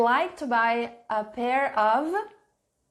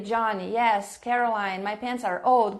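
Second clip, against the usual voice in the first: ordinary talk, nothing marked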